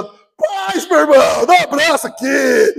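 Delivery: a cop thrilled to meet a fellow believer; joyful greeting. Retold or imitated. Officer finds fellow believer.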